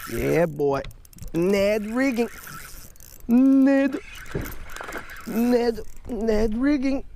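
hyped up over the catch, calling it out; excited cheer. Real, not acted. Landing a fish, hyped.

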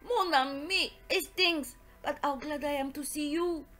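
put-on delighted, lilting French-accented detective; Poirot greeting affectionately. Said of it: "He greeted me with much affection".

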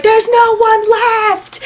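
high, drawn-out despairing cry; theater scream. Retold or imitated. Moviegoer screaming at the screen, high and slow. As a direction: wail it slowly at the screen. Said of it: "in our theater screamed".

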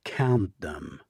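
deadpan, loud and plain; straight-faced. A narrator's reading. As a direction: say it without a flicker of humor. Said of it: "straight-faced".

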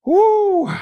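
high, loud release of emotion; joyful cry. Real, not acted. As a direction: let out a big joyful whoop. Preacher holds back tears, cheers.

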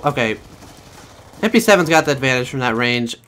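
ordinary delivery

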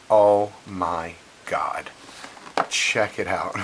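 low, very slow oh my god; stunned. Retold or imitated. Turning the package around, low and slow in disbelief. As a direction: drag it out low, floored. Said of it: "and I'm like".

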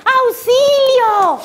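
high, drawn-out storybook call for help; cry for help. A narrator's reading. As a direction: call out for rescue, pitched high and stretched. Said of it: "gritó Rayito".

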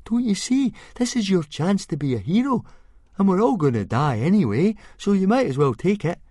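put-on high, loud, urgent boy's voice; insistent boy. Fishlegs pushes Hiccup to be a hero, high and insistent. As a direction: urge a friend to seize the chance. Fishlegs urges his friend.

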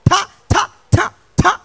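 high, sharp whacking sounds; spaced mock lashes of a cane; imitated cane strokes. Principal flogging the class, imitated whacks.

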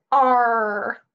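loud growled pirate arr. Acted. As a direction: roar it like a pirate. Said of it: "Sam yells".

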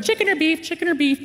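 high mock service call; imitated attendant call. Flight attendants offering food down the aisle, imitated high call.

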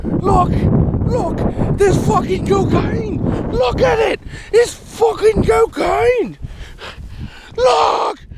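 high-pitched, excited announcing of a great find; excited and high, words stretched at the end; hammed-up excitement. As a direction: call everyone over to see your amazing discovery. Announcing a great discovery, in character.

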